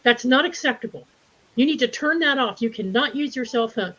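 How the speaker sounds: mock Chipotle lady scolding her in line. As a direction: imitate a bossy customer telling you off. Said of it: "And I heard this voice".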